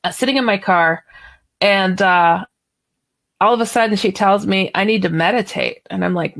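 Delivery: ordinary delivery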